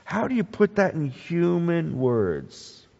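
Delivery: normal talking voice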